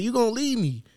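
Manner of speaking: lightly voiced high girlfriend; hint of a girlfriend impression; put-on girlfriend voice. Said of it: "I'm gonna stop doing the voice".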